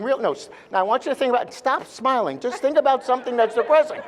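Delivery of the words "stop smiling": normal talking voice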